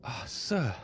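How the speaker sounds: startled wife exclaiming; exclaimed. A narrator's reading. Accused wife to husband, startled exclamation. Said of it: "exclaimed Madame de Villefort".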